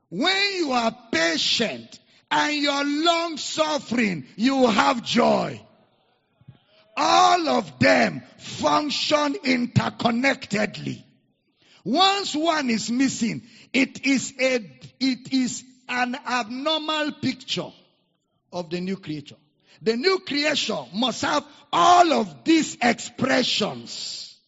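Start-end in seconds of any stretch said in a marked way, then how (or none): 0.1-15.3 loud, straining preacher's cry; preaching at full force. Real, not acted. Pastor exhorting the church, loud and straining. 19.8-24.4 loud emphatic preacher's proclamation; loud preaching. Real, not acted. Pastor driving the point home, loud.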